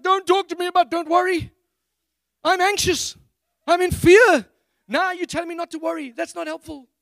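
high, loud snapping retort; hammed-up panicked protest; anxious outburst. Preacher snapping at his wife to stop saying don't worry. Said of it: "I'm like".

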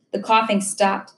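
usual speaking voice

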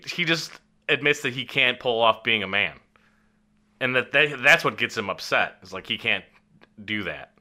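ordinary talk

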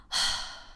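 heavy voiceless sigh; catching breath. Real, not acted. Podcast host pausing after rapid talk, breathy sigh to catch breath. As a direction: let out a big breath to recover. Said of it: "I have to catch my breath".

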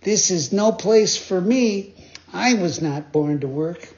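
high, slow lazy puppet voice; Pinocchio voice. Acted. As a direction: declare it lazily, high. Pinocchio refuses work.

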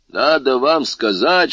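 ordinary delivery, nothing marked

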